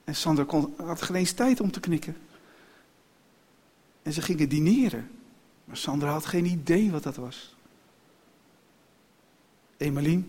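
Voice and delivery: usual speaking voice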